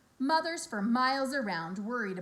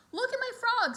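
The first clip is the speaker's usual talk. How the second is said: put-on high, loud boastful boy; boastful kid; 13 semitones higher, 6 dB louder than usual. A narrator's reading. Avery shows his frog to his aunt, high and loud. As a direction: show off the frog proudly. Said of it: "said Avery".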